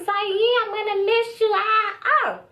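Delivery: high, drawn-out mock whine; clingy goodbye. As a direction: play up a clingy, whiny farewell. Fond clingy goodbye, acted.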